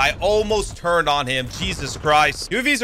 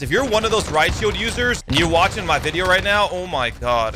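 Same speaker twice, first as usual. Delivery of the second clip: ordinary delivery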